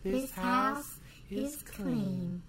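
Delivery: soft, very slow pronouncement; hushed, ceremonial slowness; spirit medium. Acted. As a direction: draw it out like an eerie medium. Quotes the film's spirit medium.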